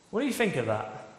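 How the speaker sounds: normal talking voice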